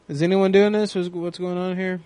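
ordinary talk

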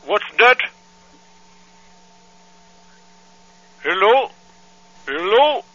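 put-on accented angry father on the phone; angry foreign dad. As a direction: scold like an outraged immigrant dad. Prank caller's put-on voice.

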